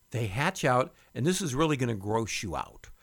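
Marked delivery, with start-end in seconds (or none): none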